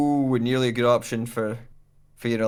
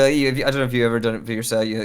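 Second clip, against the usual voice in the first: normal talking voice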